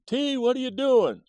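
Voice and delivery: high, loud call down to the basement; yelling down the stairs. Retold or imitated. Mom yelling down to her son in the basement, loud and high. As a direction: shout it down the stairs to find him. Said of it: "So she yells down the basement".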